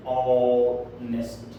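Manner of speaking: "ऑनेस्ट" stretched slowly, opening on a long, low o; drawn-out first vowel. Shown as a demonstration. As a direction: draw out the vowel that starts "ऑनेस्ट", no h. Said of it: "यानी ये एच का उच्चारण ओ". ("ऑनेस्ट" का पहला स्वर खींचकर, धीरे)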